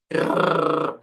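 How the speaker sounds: long rolled Rrr with trembling tongue; trilled R. Shown as a demonstration. Teacher showing how the tongue trembles, trilled R. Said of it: "a língua treme mais".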